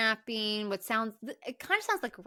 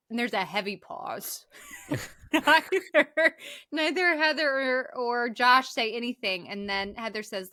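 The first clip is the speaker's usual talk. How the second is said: ordinary talk, nothing marked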